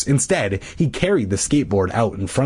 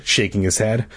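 usual speaking voice

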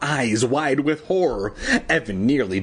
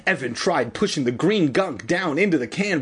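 usual speaking voice

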